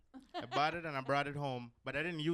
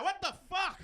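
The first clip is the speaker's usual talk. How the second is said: high, loud yell of disbelief; shocked outburst; 6 dB louder than usual. Acted. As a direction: blurt it out, loud and appalled. Acted shocked reaction to man moaning.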